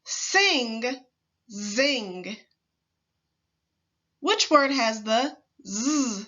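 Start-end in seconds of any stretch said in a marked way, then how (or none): 0.0-0.8 "Sing," with a long hissed s; teacher drawing out the s; hissing s. Shown as a demonstration. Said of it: "Repeat after me". 1.5-2.4 "zing." with a long buzzing z; teacher drawing out the z. Shown as a demonstration. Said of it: "Repeat after me".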